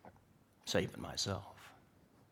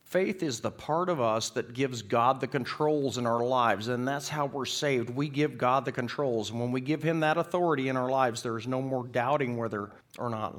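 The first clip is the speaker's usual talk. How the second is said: loud, sustained pulpit proclamation; fervent preacher in full flow; impassioned preaching; 3 semitones higher, 7 dB louder than usual. Real, not acted.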